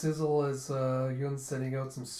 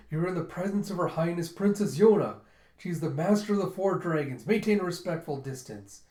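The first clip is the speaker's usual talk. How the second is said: loud, higher, emphatic announcement; indignant proclamation; 4 semitones higher, 6 dB louder than usual. Acted. Gija demanding respect for the princess, indignant. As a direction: proclaim her rank with outrage.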